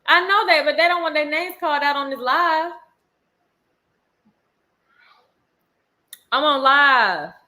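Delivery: loud, high parental call; exasperated scolding. Real, not acted. Scolds child across room during live.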